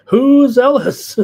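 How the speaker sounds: high, loud and slow, playing up the surprise; mock surprise. Real, not acted. Host asking his chat, in mock surprise. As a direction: ask it like the name is a total mystery. Chat asks about an unknown name.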